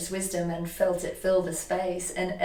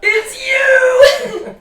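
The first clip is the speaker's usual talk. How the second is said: gasp, then shout the discovery; much higher, louder stunned recognition; astonished gasp; 15 dB louder than usual. Retold or imitated. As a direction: gasp then cry out high and loud. Recognition moment, gasp and exclaim.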